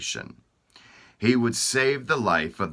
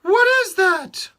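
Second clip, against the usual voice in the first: much higher, loud demanding queen; 9 dB louder than usual. Acted. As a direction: demand the answer as the anxious queen. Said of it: "the queen demanded to know".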